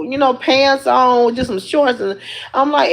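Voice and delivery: ordinary delivery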